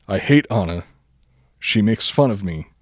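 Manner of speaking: normal talking voice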